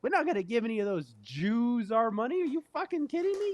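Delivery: high, outraged rep voice; hammed-up indignant insurer; mock insurance rep. Insurance company refusing to pay, outraged. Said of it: "my, uh, insurance was like".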